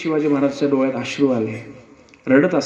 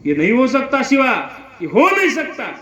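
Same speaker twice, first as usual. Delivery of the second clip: higher, louder cry of disbelief; shocked shout; 10 semitones higher, 3 dB louder than usual. Acted. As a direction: shout it as if refusing the truth. Fazal Khan exclaims in disbelief.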